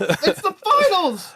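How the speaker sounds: high slow yell that breaks; voice cracking. Real, not acted. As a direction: shout it till the voice cracks. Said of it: "My voice cracked".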